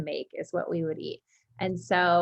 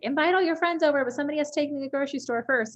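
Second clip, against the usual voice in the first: high, loud grandma announcing orders; imitated bossy grandma; 8 semitones higher, 3 dB louder, much faster than usual. Grandma off the plane to her granddaughters, imitated high announcement. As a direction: play a grandma issuing cheerful orders, pitched up.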